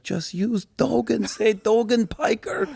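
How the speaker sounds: high, slow grandfather voice; imitating grandfather. Grandfather suggesting a new name to the grandson, high and slow. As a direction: pitch it way up and take it slow, like the old man giving advice. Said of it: "So he's like".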